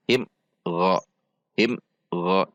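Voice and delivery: "Him" cut short, pause, then "Gha"; jerked and broken. Shown as a demonstration. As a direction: chop "Him" away from "Gha". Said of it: "Dihentak dan diputus". ("Him", "Gha" dihentak dan diputus)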